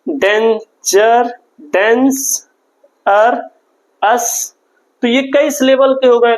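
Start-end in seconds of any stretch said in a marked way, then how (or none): 0.1-4.5 "danger, dense, अर, अस" split into separate chunks with pauses between; syllable by syllable. Shown as a demonstration. Teacher showing students the syllables, broken apart with pauses. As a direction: break the word into its parts slowly. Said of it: "अब dangerous में आप देखिए".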